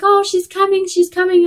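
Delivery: high-pitched retold excitement; imitated excited announcement. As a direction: imitate thrilled staff, voice lifted high. Staff announcing the baby is coming.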